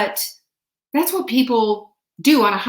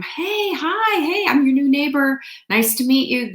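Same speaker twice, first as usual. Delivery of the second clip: much higher, bright sing-song hello to new neighbors; uber friendly greeting; 6 semitones higher than usual. Retold or imitated. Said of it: "I am uber friendly".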